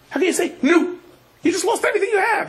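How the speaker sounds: high-pitched incredulous cry; can't believe he's so calm; disbelieving cry at a total loss; shocked protest. Retold or imitated. Followers protest to the Rebbe.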